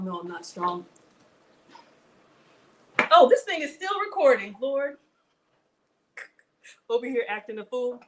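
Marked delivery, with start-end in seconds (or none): 3.1-5.0 higher and much louder, startled realization; caught off guard. Real, not acted. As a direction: jolt as if caught out on camera. Notices the stream still recording.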